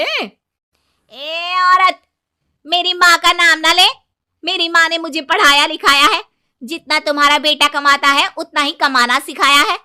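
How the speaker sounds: bride shouting down her mother-in-law in anger; furious daughter-in-law. Acted.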